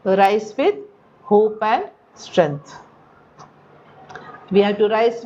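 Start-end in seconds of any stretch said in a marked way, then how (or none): 0.0-2.8 very slow stressed answer. Shown as a demonstration. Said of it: "answering own question slowly".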